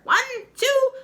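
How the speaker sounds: very high, loud count rising; shrill witch counting. Acted. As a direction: count out the spell slowly.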